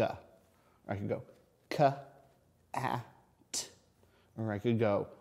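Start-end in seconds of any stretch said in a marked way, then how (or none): 1.7-3.7 "k, a, t" as three separate sounds; sounding out. Shown as a demonstration. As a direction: split cat into "k", "a", "t". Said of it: "I'm stretching out those words".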